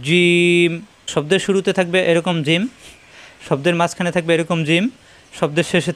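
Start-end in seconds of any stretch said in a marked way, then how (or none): 0.1-0.7 জিম held long, pressed on the palate; pressed jim. Shown as a demonstration. Teacher shows the letter's articulation, a long pressed জিম. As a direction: press mid-tongue to palate and stretch জিম. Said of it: "তালুতে চাপ দিয়ে উচ্চারণ করতে হয়".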